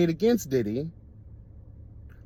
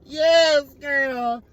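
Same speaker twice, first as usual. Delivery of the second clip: hyped laughing cheers; 7 semitones higher, much slower than usual. Real, not acted.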